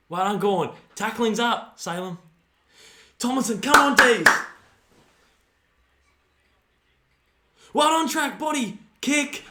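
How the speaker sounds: high and loud cheering each touch; excited cheering. Real, not acted. Fan cheering the Dees' tackling at the TV, excited shout. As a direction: cheer them on from the couch.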